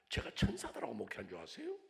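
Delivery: ordinary delivery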